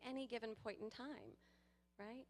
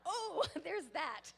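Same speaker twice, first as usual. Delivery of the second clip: loud, high, slow laughing; real laughing aha; laughing realization; 10 semitones higher, 6 dB louder, much slower than usual. Real, not acted. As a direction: laugh through the words. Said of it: "I was like".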